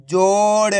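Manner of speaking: जोड़ with a long held "o" and light final ड़; half final letter. Shown as a demonstration. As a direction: draw out जोड़ for learners, halving the ड़. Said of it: "last वाले अक्षर का उच्चारण आधा किया जाता है". (जोड़ को खींचकर, आखिरी ड़ आधा)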